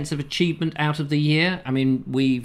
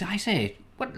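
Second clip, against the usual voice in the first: soft, higher mock-English exclamation; old-film English accent; 8 semitones higher, 7 dB quieter than usual. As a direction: lightly imitate a fifties English film accent. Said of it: "they're all talking like this".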